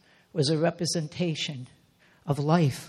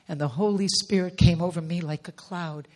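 ordinary talk

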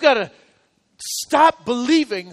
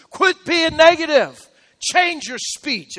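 emphatic command; 6 semitones higher, 4 dB louder, slower than usual. Real, not acted. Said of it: "preacher commands congregation".

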